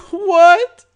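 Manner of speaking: high, loud terrified yelp. Acted. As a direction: yelp in panic. Terrified victim.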